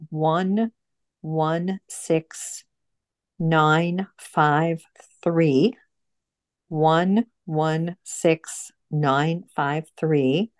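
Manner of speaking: each digit read out slowly and apart; careful number read-out; slow dictation. Real, not acted. Reading out a number to note down.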